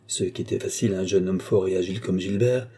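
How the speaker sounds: ordinary delivery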